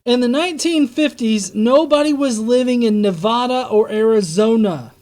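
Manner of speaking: loud furious rant, yelling at the screen; angry shouting. Real, not acted. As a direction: shout it out in disbelief.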